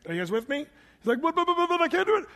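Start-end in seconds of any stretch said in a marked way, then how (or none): 1.2-2.2 high, rapid stammering panic; louder, fast sputtering in a put-on jittery voice; Don Knotts. Preacher mimicking Moses balking at God's command, as a Don Knotts stammer. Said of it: "This is Don Knott speaking right here".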